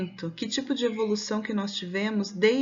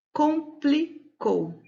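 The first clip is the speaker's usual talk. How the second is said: "Complicou" very slowly, higher, in three pieces com, pli, cou; syllable by syllable; 6 semitones higher, much slower than usual. Shown as a demonstration. Teacher giving pupils a new example, "Complicou" split slowly by syllable. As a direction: break "Complicou" into separate syllables with pauses. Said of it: "pronuncio as sílabas pausadamente".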